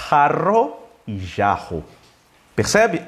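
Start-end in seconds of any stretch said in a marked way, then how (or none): 0.1-0.8 "Jarro" with a throaty h and rolled rr, drawn out; Spanish pronunciation. Shown as a demonstration. Teacher contrasting two languages, Spanish pronunciation. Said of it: "se fala totalmente diferente". 1.2-1.8 "jarro" with a soft zh and h-like rr; Portuguese pronunciation. Shown as a demonstration. Teacher contrasting two languages, Portuguese pronunciation. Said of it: "se fala totalmente diferente".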